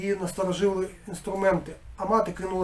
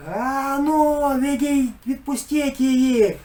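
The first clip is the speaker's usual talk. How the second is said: higher, louder threatening cry; menacing shout; 7 semitones higher, 5 dB louder, much slower than usual. A narrator's reading. Hanna shouting at the attackers, menacing high yell. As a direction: order them to let her go. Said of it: "грізно гукнула Ганна".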